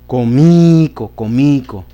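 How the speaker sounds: comico loud and slow with the weight on MI; stress on MI. Shown as a demonstration. Teacher showing a wrong stress to the class. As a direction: push the MI of comico hard. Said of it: "diría".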